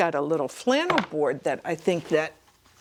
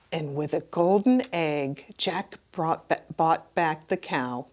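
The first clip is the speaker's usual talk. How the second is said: ordinary delivery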